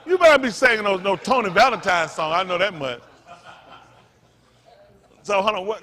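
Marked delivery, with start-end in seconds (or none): 0.1-3.0 loud, high laughing tease; boisterous, laughing joke; laughing banter. Real, not acted. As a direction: crack up while teasing. Joking about the song, laughing.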